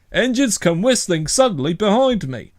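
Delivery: lightly voiced cross policeman. A narrator's reading.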